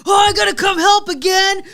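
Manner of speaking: mock complaining children; grumbling kids. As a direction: whine like kids dragged into helping. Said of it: "So the kids are like".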